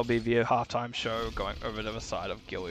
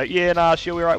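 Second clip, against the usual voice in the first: casual New Zealand slang; easygoing Kiwi mate; Kiwi accent; 5 semitones higher, 6 dB louder than usual. Retold or imitated. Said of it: "I wanted it to very much just do a".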